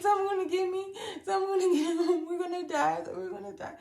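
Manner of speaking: high, wailing mock panic; panicked wail. Panicking on a jet ski far out at sea, high mock wailing. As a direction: imitate herself freaking out on the jet ski, half crying. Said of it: "I was like".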